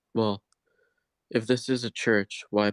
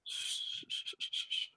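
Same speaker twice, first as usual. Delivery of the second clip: soothing shushing; 15 dB quieter, slower than usual. Acted. Soothing again.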